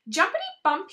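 usual speaking voice

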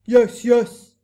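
very loud giant retort; booming. A narrator's reading. Blood Bottler contradicting the BFG, booming retort. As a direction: boom back over the protest. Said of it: "boomed the Blood Bottler".

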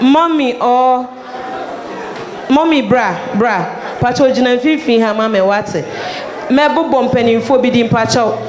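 ordinary talk, nothing marked

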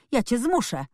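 heated boy's call, lightly acted. A narrator's reading. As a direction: call it out as a threat. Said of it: "wołał Colin".

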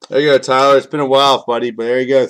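calling out to Tyler with a cheer; congratulating. Real, not acted. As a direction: cheer him on, loud and happy. Congratulating the lucky buyer.

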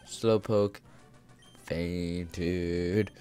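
low, soft, drawn-out word; mock announcer. Announcing that Slowpoke fainted, low and drawn-out. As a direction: sink low and stretch it out.

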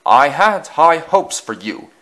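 slow, very loud reprimand; stern lecture. A narrator's reading. Fat Controller confronting Easton in the yards, stern booming. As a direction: boom it out slowly and sternly. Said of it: "boomed the Fat Controller".

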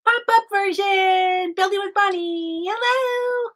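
excited show opening. Real, not acted. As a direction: announce the show in long, high notes.

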